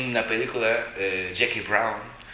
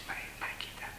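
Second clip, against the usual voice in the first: breathy whispered aside, much softer; 16 dB quieter than usual. Retold or imitated. Joking to friends about the monk's flirting, whispered. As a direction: drop to a secret whisper.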